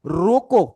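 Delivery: रोको stressed loudly, then a stop; pause after रोको. Shown as a demonstration. Teacher showing where the comma goes, रोको cut off by a pause. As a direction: set रोको apart as a command of its own. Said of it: "comma लगा दूं".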